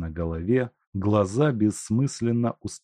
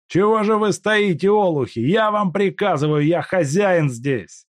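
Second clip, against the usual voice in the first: furious order; 11 semitones higher, 6 dB louder than usual. Acted. Куликов кричит на толпу.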